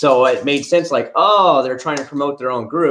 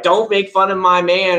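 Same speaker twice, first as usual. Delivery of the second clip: mocking fangirls; 7 semitones higher, 3 dB louder than usual. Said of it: "all these people are like".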